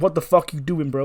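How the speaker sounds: loud, sharp, high outraged demand; shocked wrestler yelling at a friend; alarmed yelling. Retold or imitated. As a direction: yell it at him to stop. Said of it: "He's like".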